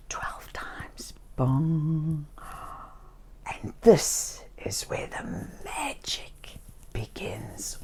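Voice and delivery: breathy whispered secret; hushed whisper. Acted. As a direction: whisper it like magic is starting. Midnight magic moment.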